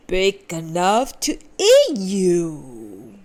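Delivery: slow, loud, growled wolf bark. A narrator's reading. Wolf throwing off the disguise to pounce, loud slow bark. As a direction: snarl it out slowly. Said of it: "barked the wolf".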